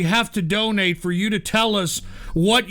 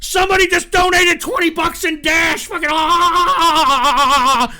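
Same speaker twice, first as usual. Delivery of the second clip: excited shout; 5 semitones higher, 4 dB louder, slower than usual. Real, not acted. Stunned by a surprise crypto donation.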